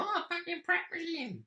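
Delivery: high-pitched mock parent voice; mimicking other parents. Parent recommending baby gear, high mock enthusiasm. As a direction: squeak it like an overkeen parent. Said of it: "Most people go".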